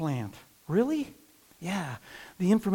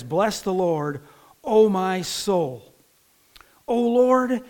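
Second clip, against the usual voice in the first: reverent scripture reading; 4 dB louder, slower than usual. Acted. Preacher reading the psalm aloud.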